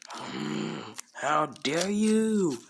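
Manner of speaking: offended pirate. Retold or imitated. Alvida offended by insult.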